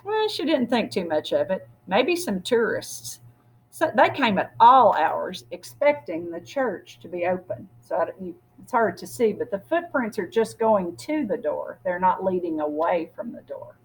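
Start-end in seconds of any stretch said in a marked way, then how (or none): none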